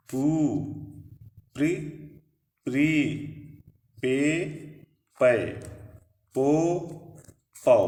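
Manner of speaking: spaced syllables from प to पह, each one short and distinct; pronunciation drill. Shown as a demonstration. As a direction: model each syllable so students can repeat it. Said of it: "एक बार फिर से उच्चारण करते हैं". (रुक-रुक कर अक्षरों का उच्चारण)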